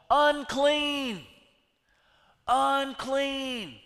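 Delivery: high, loud call of unclean; calling out a warning. Retold or imitated. Lepers announcing themselves in town, loud call. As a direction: cry it out so everyone steps back. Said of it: "Or they would call".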